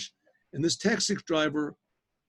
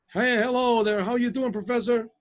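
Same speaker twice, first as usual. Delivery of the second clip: higher, louder cheery phone hello; hearty greeting; 7 semitones higher, 4 dB louder than usual. Retold or imitated. Mister Gross greets professor on the phone, hearty. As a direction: call it out like a long-lost acquaintance.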